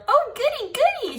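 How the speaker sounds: very high, loud squeal of delight; thrilled squeal. Acted. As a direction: squeal it out like a thrilled little girl. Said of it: "May squealed, very thrilled".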